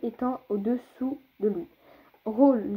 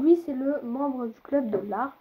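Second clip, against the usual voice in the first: ordinary delivery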